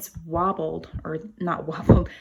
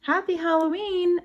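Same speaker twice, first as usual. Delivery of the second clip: high, bright group shout; shouted greeting; 11 semitones higher than usual. Acted. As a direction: call it out with party cheer. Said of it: "everyone shouted".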